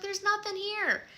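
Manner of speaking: very high, strained mock protest; high-pitched retold dismissal; exasperated parents. Parents answer screaming children.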